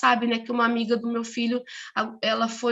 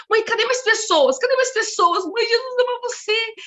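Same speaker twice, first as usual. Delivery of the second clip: put-on high, louder, eager child's cries; imitated excited shouting; 9 semitones higher, 5 dB louder than usual. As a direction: act out a girl calling eagerly to mum. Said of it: "começou a gritar na rua".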